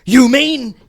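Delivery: loud shocked gasp of a question; shocked realization. Acted. Shocked revelation.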